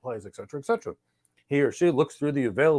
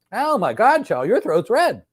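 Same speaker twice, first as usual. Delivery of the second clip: mock doctor gasp, higher and louder; a doctor playing alarmed at a red throat; mock surprise; 8 semitones higher, 5 dB louder, slower than usual. [name] as the doctor, telling Tal her throat looks red, mock surprise. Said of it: "So I look in her ears".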